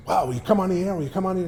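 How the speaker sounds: high, eager mock plea to come on air; eager producer.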